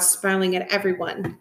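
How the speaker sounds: ordinary delivery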